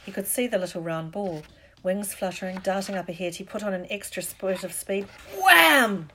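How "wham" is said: explosive high shout, falling; loud comic-book crash word; sudden exclamation. A narrator's reading. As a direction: burst out with the impact. Narrator voices the sudden crash.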